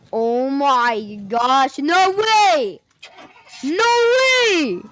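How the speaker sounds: rising, high amazed delight; dawning joy. Real, not acted. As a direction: build from awe to thrilled. Amazed at something in game.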